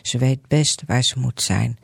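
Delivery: ordinary delivery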